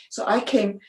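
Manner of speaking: ordinary delivery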